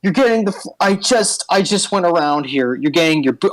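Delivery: a touch of a prissy cleaner scolding; fussy janitor cube. Acted. DM voices Janet the cube.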